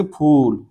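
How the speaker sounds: फूल with a long, held OO; stretched vowel. Shown as a demonstration. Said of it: "फूल में ज्यादा खींचना पड़ा".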